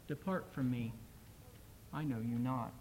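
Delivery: a hint of a sorrowful Jesus, hushed; soft judgment. A narrator's reading. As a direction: let the verdict fall quietly and sadly. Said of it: "He whispered".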